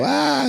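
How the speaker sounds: short, high startled mock scream. Bystanders spooked by the zombie prank, high mock scream. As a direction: yelp like someone jumped by a zombie. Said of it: "people go".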